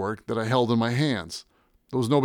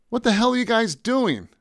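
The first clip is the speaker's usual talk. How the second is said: high-pitched, indignant demand; indignant challenge; 11 semitones higher than usual. Acted. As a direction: confront them, voice jumping up. Confronting men burning the paintings.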